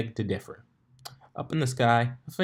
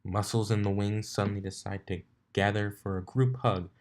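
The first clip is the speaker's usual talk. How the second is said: ordinary delivery, nothing marked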